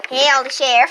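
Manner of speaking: lightly put-on country accent; hint of a Southern country accent. Girl demoing her country accent for viewers. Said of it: "wanna hear my country accent".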